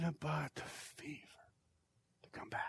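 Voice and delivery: whispered, dropping to almost nothing; sudden whisper. Real, not acted. Pastor confiding to the congregation, whispered. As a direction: pull them in with a hush. Drops to a hush mid-sermon.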